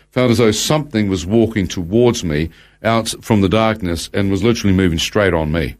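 usual speaking voice

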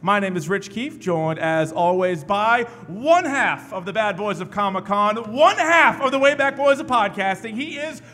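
booming slow announcer build-up; hype announcer intro. Acted. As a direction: roll out the names like a ring announcer. Hypes the show's cast intro.

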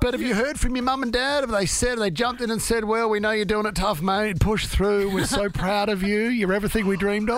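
mock proud parents voice; playing the doting parents; parents' voice.

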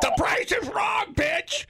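high, slow yelled movie line; movie quote shout. Pretend. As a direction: bellow it like an angry golfer. Quoting Happy Gilmore.

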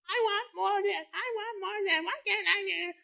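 put-on high whining child's voice; whiny kid. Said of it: "that kid is".

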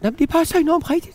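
a hint of the friend sobbing her reply; tearful friend.

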